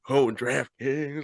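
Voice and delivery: badly faked American, slowly drawn out; mock your own poor American accent; hammed-up American imitation, slow; terrible American accent. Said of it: "It's better than my".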